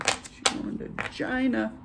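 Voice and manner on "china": CHINA said as "Jyna"; exaggerated "JY-nah" for CHINA; imitated pronunciation. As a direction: mimic his CHINA, starting on a J sound. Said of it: "I love the way he says it".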